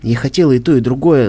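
plain reading voice

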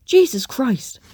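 loud, high startled bellow. A narrator's reading. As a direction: bellow it out in shock. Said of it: "he himself had bellowed".

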